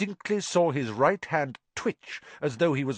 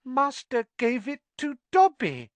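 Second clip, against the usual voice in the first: squeaky house elf; 12 semitones higher, 3 dB louder than usual. Acted. Dobby the elf.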